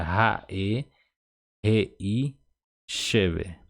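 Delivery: "ra, e, Rei Sheve" with a breathed Portuguese R, as in rato; aspirated H sound. Shown as a demonstration. Teacher shows learners the H like Portuguese R, aspirated and separated. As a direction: breathe the R of "ra" and "Rei", parts spaced out. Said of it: "Então, você falaria".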